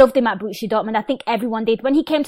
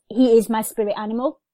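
ordinary talk, nothing marked

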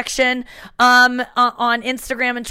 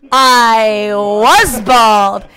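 loud, drawn-out declaration; hammed-up, very slow proud retort; George; 6 dB louder, much slower than usual. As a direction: stretch WAS way out, slow and loud. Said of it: "And he goes".

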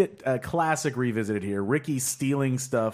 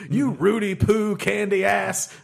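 slow, high, laughing insult; wrestling promo; 8 semitones higher, 4 dB louder, slower than usual. Pretend. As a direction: drag out the insult while laughing. The Rock insult.

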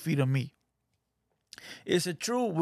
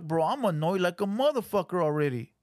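ordinary delivery

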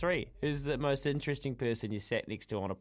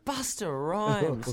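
awed repeat; 2 semitones higher, much slower than usual. Real, not acted. Host repeats the name in awe.

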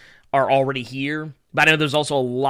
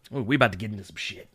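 quick low mutter; fast mumble; 6 semitones lower, 5 dB quieter, faster than usual. Shown as a demonstration. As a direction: rush it out under breath. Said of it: "repeats line slowly".

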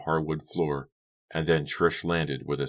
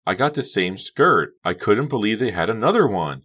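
loud, raised happy voice; delighted, pitched up and loud; happy shouting; 6 semitones higher, 6 dB louder than usual. A narrator's reading. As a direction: beam as you call over the music. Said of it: "She beamed happily at Becca".

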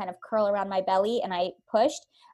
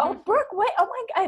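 mom's astonishment; 11 semitones higher, 6 dB louder than usual. Retold or imitated. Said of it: "She's like".

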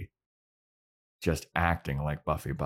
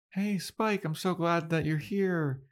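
put-on much higher, chirpy robot-girl greeting; chipper Buffy Bot; 12 semitones higher than usual. Buffy Bot greets Spike on seeing him, high and chipper. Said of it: "All chipper-like".

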